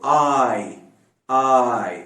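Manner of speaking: आई drawn out slowly as one glide; open-mouthed आई. Shown as a demonstration. Teacher repeating the sound for students, long open आई. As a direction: open wide on आ and slide into ई. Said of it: "ये वाला sound उसके साथ".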